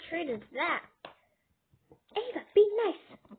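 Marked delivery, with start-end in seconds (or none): 2.2-3.3 scolding. Real, not acted. Parent scolding child.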